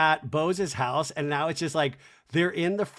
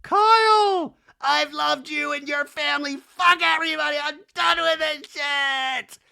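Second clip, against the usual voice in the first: high, loud tearful-style tirade; mock screaming; 12 semitones higher, 7 dB louder than usual. Kyle screaming at the group, mock hysterical. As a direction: scream it like a hammed-up meltdown. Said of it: "then she screams more on top of the crying".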